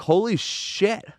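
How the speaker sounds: high, slow, stunned; drawn-out awe at the sight; amazed exclamation. Retold or imitated. Retold amazed reaction.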